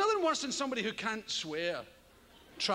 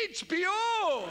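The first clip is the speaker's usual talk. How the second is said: slow, higher and louder, self-assured; proud answer; 6 semitones higher, 3 dB louder, slower than usual. Retold or imitated. Comic answering a friend about the box, proudly. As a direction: answer as if it's obvious. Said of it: "and people say".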